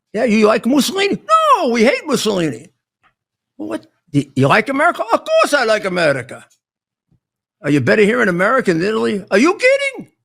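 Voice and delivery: put-on high, animated Italian-accented back-and-forth. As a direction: act out an Italian grandfather's heated exchange. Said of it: "he would say in his Italian accent".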